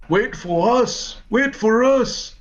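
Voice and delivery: high, slow calling out; a high, drawn-out call from the lagging cultists. Acted. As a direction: cultists crying out to be waited for.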